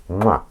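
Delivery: short "mwah" smooch; kiss sound. Real, not acted. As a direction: plant a noisy kiss on the cat. Kisses cat on head.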